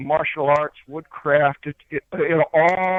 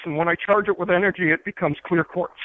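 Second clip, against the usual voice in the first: ordinary delivery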